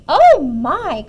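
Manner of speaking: put-on loud shocked old woman; alarmed storybook outcry; startled cry. A narrator's reading. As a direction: cry out with a big jolt. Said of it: "cried the tiny old woman".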